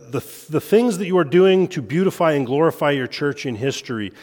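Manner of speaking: ordinary delivery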